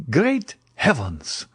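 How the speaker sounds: slow, drawn-out "Great heavens!" rising high; startled at the window; sudden exclamation. A narrator's reading. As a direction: gasp it out in sudden alarm. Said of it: "suddenly exclaimed Debray".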